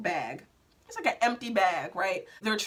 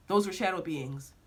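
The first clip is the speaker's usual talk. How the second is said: lower, understated "shadow beings"; voice in a dream; 4 semitones lower, 4 dB quieter than usual. Retold or imitated. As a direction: say "shadow beings" evenly, like a voice overheard. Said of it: "I heard this voice that's saying".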